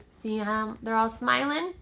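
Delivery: ordinary delivery, nothing marked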